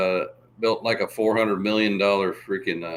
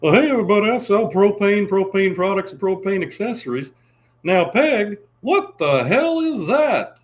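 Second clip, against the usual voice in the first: Hank Hill impression; 9 semitones higher, 5 dB louder than usual. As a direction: play the propane salesman straight. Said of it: "gonna do Hank".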